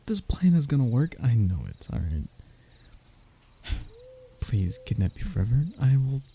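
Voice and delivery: usual speaking voice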